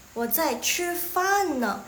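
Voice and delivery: 呢 with the weight on e, n only passing; light final particle. Shown as a demonstration. Teacher showing 呢 to students, stressing its e over the n. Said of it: "penekanannya lebih ke e, n-nya itu terdengar".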